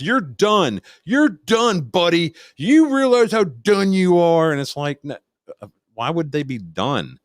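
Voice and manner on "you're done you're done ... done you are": high, louder taunting threat, slowing at the end; mock yelling threat. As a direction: yell a smug threat at the cops. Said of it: "he's yelling at the cops".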